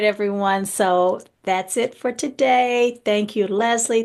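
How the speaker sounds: usual speaking voice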